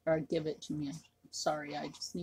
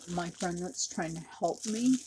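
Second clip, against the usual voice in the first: usual speaking voice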